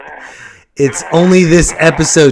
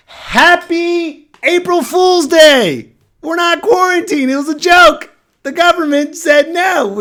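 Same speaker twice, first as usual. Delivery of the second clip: pitched way up, slow and bright; high, slow, mock-cheerful announcement; high excited voice; slower than usual. Joking announcement, raised high.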